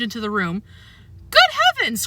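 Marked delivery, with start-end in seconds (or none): 1.3-2.1 high shocked cry; startled woman crying; cried out. A narrator's reading. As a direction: gasp it out, pitched way up. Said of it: "cried Helen".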